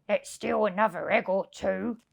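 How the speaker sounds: lightly voiced scheming crook; sly thief. Len plotting to Hugh, a lightly voiced sly whisper. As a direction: murmur it like a sneaky burglar. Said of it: "Said Lanky Len to Hefty Hugh".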